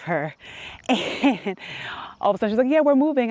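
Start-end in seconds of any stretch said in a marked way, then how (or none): 2.8-3.3 high, louder cheerful announcement; mom's excited announcement. Retold or imitated. Imitating mom telling her daughter they're moving, excited. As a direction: announce it like thrilled news.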